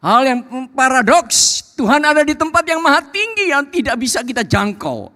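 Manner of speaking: high, loud proclamation; impassioned, high-pitched pulpit delivery; full-force preaching. Real, not acted. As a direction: send it soaring over the congregation.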